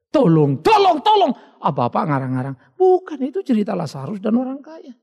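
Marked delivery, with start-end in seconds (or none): 0.1-1.3 high, loud cries of help from the damned; desperate screams for help. Retold or imitated. Sinners in hell crying out for rescue, panicked screaming. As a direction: scream for help, too late. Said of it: "baru teriak".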